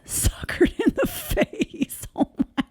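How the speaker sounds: laughing at her son's accident photo. Real, not acted. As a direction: giggle it out despite yourself.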